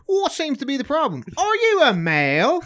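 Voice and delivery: high, loud officious policeman; hammed-up officer barking rules; mock cop voice. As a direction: play a pompous cop questioning a man. Mock cop stopping a man.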